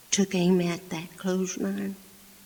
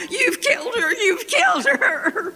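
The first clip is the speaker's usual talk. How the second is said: much higher, loud frantic cry; panicked mother; 7 dB louder than usual. Retold or imitated. Mother running out, sure her daughter is dead, frantic wail.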